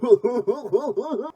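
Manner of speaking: high, wavering mock-excited "hoo-hoo-hoo"; loud, silly run of high hoots. Reviewer jeering at the filmmakers' new ideas, high mocking hoots.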